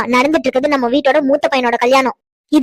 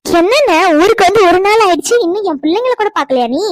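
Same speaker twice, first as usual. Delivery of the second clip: higher-pitched, lively sisterly complaint; mock-offended and high; playful reproach; 4 semitones higher than usual. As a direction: chide him in a raised, lilting voice. Sister chides brother for not visiting.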